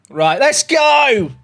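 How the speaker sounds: hyped. Real, not acted. Streamer hyping chat up to start the match, loud and drawn out. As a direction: rally chat to start the game.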